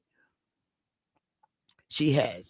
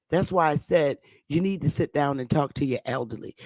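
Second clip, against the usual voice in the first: ordinary talk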